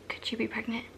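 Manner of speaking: hushed, whispery voice of a mom; whispered question. Retold or imitated. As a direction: ask it very softly, almost whispering. Mom asks a delicate question.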